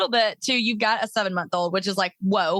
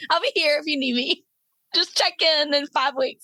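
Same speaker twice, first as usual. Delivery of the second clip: higher, teasing sing-out on behalf of someone; playful refusal; 5 semitones higher than usual. Pretend. Host playing the mom who stays on couch, teasing high voice. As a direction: call it out high, slowing at the end.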